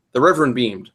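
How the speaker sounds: ordinary delivery, nothing marked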